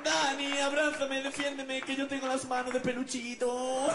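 high, loud, whiny panda pleading; scared panda voice. Acted. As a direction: beg for help like a terrified girly panda. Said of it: "por qué hablas como mi novia". (oso panda asustado con voz aguda de novia)

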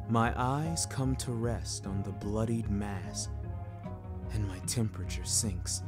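plain reading voice

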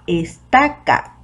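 slow "estaca" with TA held and stressed; stressed middle syllable. Shown as a demonstration. Teacher showing the strongest syllable of "estaca", stress on TA. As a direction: say "estaca" in beats, es-TA-ca. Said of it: "la sílaba que suena más fuerte".

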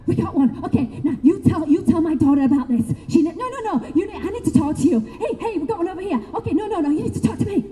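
laughing high-pitched mock shouting of spirits; clamouring spirits. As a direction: play eager spirits crowding in, calling out over each other.

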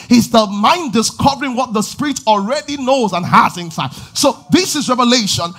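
loud, high, driving preacher's build; impassioned sermon climax, rising to a shout; full-force preaching. Real, not acted. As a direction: preach it hard and rising to the crowd.

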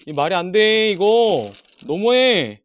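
loud, high and fed up; exasperated shout. Real, not acted. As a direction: wail at the unfairness. Missing screw, loud complaint.

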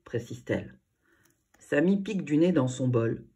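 ordinary delivery, nothing marked

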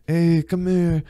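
hammed-up French-accented come-on. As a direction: put on a French flirt's accent. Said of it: "talking the French accent".

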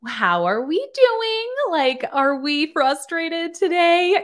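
put-on high, slow, condescending baby talk. Mocking a boss talking down to nurses. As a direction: talk to them like a toddler. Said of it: "how you talk down to people".

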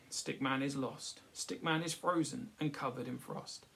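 ordinary delivery

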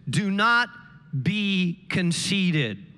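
very slow, drawn-out warning; slow, firm preacher's warning; emphatic slow. Acted. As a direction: stretch out be conceited. Slow emphatic scripture ending.

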